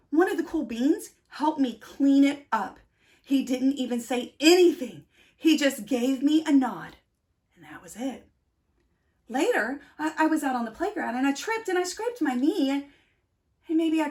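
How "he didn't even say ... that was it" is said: plain reading voice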